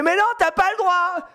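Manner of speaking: high-pitched child's protest, imitated; put-on kid tantrum voice; outraged little girl. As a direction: mimic a child shrieking in objection. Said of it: "Et la petite, elle fait".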